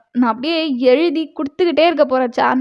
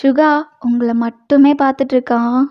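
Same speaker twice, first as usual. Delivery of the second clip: ordinary delivery, nothing marked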